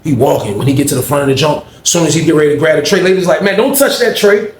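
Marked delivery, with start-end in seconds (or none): 3.4-4.5 high, sharp warning; imitating a lunch lady's warning. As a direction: bark it high like the lunch lady. Lunch lady stopping him.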